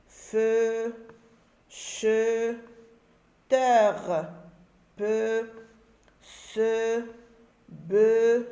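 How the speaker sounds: steady, clearly separated syllables with pauses; syllable drill. Shown as a demonstration. Teacher reading a syllable chart aloud to pupils. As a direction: read each syllable on its own. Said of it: "les syllabes".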